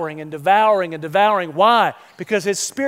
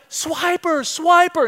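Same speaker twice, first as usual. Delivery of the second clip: put-on high children's call, pitched way up; kids yelling; 9 semitones higher, slower than usual. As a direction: shout it like kids pointing at the TV. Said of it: "the kids are yelling".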